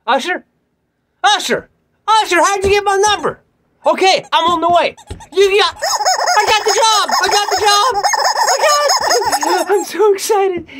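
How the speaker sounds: very high, loud, shouted joy; pretend excited phone call. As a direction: shout like you just got the job. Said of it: "Hold on, I'm calling".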